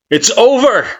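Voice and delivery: high, loud triumphant yell; shout. Acted. Kara shouting to you across the storm, loud yell. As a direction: hurl it across the wind. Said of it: "they shout".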